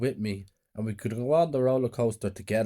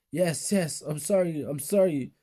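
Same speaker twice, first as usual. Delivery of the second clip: higher, a touch of frightened pleading; mild scared pleading; 6 semitones higher than usual. A narrator's reading. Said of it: "Scared, crying, I said".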